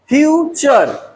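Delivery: Future slowly in two beats, few, then चर; split into syllables. Shown as a demonstration. Teacher showing two sounds in future, split into syllables. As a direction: break future after the first syllable. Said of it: "टू sound".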